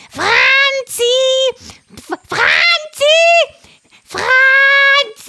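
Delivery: high, loud parrot calls; calling loudly. A narrator's reading. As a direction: call out across the forest. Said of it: "flog laut rufend". (lautes Rufen des Papageis)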